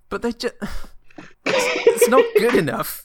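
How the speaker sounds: high, laughing protest. Real, not acted. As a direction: crack up while objecting.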